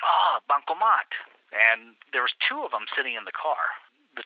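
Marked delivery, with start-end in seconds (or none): 0.0-1.1 policeman realizing. Retold or imitated. Policeman recognizing the word.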